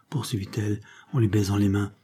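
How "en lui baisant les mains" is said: usual speaking voice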